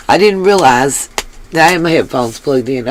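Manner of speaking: ordinary talk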